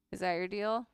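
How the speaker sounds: ordinary talk, nothing marked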